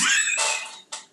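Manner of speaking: breathy stifled laugh; a giggle escaping despite trying. Real, not acted. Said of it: "I'm trying not to laugh".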